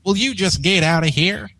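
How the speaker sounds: lightly voiced country mare, wry; dry Applejack. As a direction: mutter the joke under the breath.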